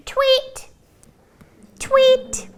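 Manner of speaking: high tweeting like a little bird. Acted. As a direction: chirp it like a bird. Pig now acting as bird.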